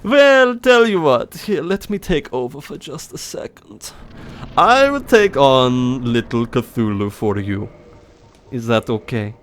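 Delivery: put-on German-accented character, high and swooping; Heinrich. German character takes over the fight, theatrical accented voice. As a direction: offer help pompously in a German accent. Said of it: "my old German voice".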